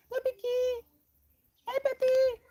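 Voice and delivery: tiny squeaky falsetto; high-pitched baby voice. Shown as a demonstration. Demonstrating pet talk to the puppy, tiny high squeak. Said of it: "high-pitched voices and baby voices".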